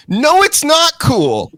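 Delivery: high, loud exasperated retort; indignant outburst. Retold or imitated. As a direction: fire back in mock outrage, drawing out the first words. Acted indignant reaction to show.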